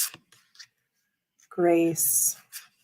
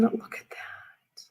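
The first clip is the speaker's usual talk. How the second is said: whisper; 4 semitones higher, 9 dB quieter, much slower than usual. Real, not acted. Admiring a card.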